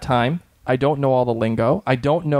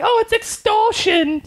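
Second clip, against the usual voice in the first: much higher, slower, prissy mock Claire; mock prim Claire; slower than usual. Mocking Claire to the Watch, much higher and slower. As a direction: sneer it up high like a know-it-all. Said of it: "And she's like".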